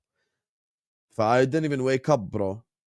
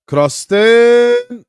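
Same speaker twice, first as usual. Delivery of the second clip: excited call; 11 semitones higher, 11 dB louder than usual. Real, not acted. Live match moment called out.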